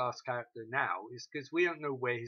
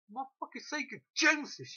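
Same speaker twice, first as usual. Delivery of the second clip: high, hammed-up exasperated yell; imitating Peter, high and hammed-up; mock rant; 11 semitones higher, 3 dB louder than usual. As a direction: squeal it like a frantic hero.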